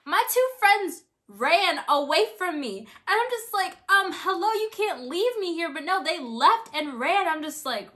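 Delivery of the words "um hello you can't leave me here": louder and higher, calling after them; indignant call. Retold or imitated. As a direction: shout after friends who abandoned you. Friends ran away from her.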